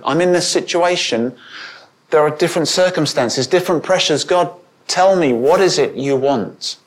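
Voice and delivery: exasperated plea for guidance; pleading with God. Acted. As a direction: press God urgently for an answer. Acted anguished prayer demanding an answer.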